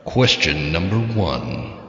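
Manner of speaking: low, slow, reverb game show voice; deep slow announcer with echo; echo effect voice. Shown as a demonstration. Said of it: "let's turn that button up".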